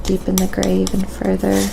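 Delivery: ordinary talk, nothing marked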